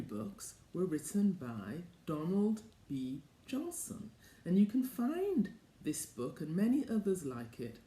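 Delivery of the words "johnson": plain reading voice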